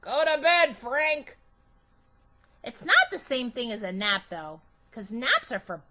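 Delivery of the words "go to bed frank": a stern grandma sending grandpa to bed; louder, bossy grandma voice; Grandma Miller scolding. Acted. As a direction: tell a dozing husband to go to bed. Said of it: "And Grandma Miller says".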